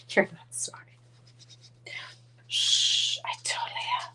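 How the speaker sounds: unvoiced playful whisper. Real, not acted. Whispering back and forth with a friend off camera. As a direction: hush it like a secret.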